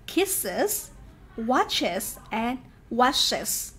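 slow "kisses, watches, washes", each ending in a separate iz syllable. Shown as a demonstration. Teacher modelling endings after sibilants, slow clear iz. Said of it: "À, âm is".

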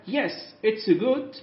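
slow teacher read; slow modelling. Shown as a demonstration. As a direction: stretch it out for learners. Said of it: "من الجيد".

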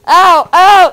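loud high ow of pain; pained yelps. Real, not acted. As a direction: yelp as the arrows hit. Shot by flaming arrows.

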